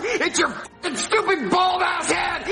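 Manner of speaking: furious chanted insults; angry shouting. Acted. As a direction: yell it in a rage. Raging at Daddy.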